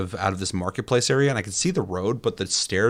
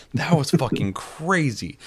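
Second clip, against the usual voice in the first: slow, stunned and higher, savoring each word; amazed disbelief; 7 semitones higher, slower than usual. Retold or imitated. Gamer retelling a crazy crash to friends, slow and amazed. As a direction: let the shock stretch the words out. Retelling a wild game glitch.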